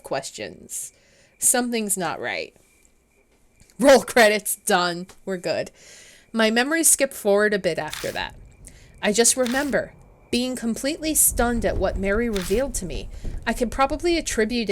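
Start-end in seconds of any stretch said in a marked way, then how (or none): none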